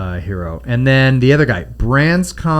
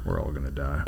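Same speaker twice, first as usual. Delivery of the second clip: deep, much softer; low doomed voice; 10 semitones lower, 12 dB quieter than usual. Retold or imitated. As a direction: rumble it low and hushed. Low doom parody.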